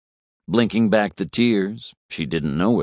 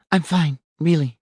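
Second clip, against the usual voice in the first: high, shaky insistence; young woman's voice; 10 semitones higher than usual. A narrator's reading. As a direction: force a brave answer, higher. Isabel, shaken young woman.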